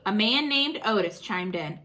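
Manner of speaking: ordinary delivery, nothing marked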